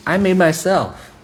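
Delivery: high-pitched mock defiance; proud boasting. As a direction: act out a man puffed up with pride. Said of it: "there's a pride of man that says".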